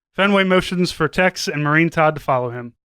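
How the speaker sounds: ordinary talk, nothing marked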